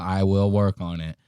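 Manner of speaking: ordinary talk, nothing marked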